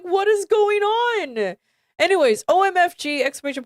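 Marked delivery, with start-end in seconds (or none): 0.0-1.6 bewildered. Real, not acted. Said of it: "That's why I'm like".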